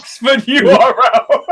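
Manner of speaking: slow words through a laugh. Real, not acted. Laughing at his mate's joke, words spoken through the laugh. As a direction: laugh out the phrase, drawn out. Repeats phrase while cracking up.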